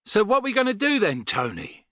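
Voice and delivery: high, sudden blurted question. A narrator's reading. Rod to Tony after a stunned silence, blurting out. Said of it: "Rod blurted out at last".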